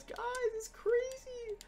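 overwhelmed with excitement; squeaky disbelief; freaking out. Real, not acted. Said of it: "I'm freaking out".

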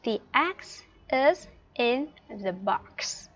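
very slow, word by word; slow English with gaps between words; teacher's model sentence. Shown as a demonstration. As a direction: model the sentence slowly for learners. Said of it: "cô có thể miêu tả bức tranh này là".

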